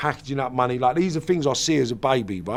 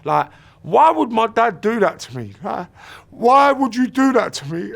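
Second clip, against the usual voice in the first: tearful why; 7 semitones higher, 3 dB louder than usual. Retold or imitated. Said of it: "I cried and I sobbed".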